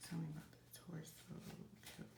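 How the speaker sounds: soft, quick murmur. Real, not acted. Reader quietly asking the reading about the killer, murmured. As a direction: ask it under your breath.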